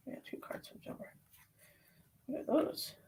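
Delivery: soft fast whisper; whispered aside. Real, not acted. Reader noticing flipped cards, whispered aside. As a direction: whisper it to yourself.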